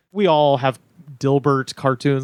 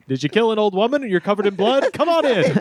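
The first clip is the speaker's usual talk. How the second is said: put-on mid-Atlantic accent; 6 semitones higher than usual. Old-timey hotel ad bit.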